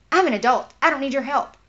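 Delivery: loud, imitated irritated retort; retold snapping. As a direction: act out someone snapping at a helper. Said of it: "this person snapped at me".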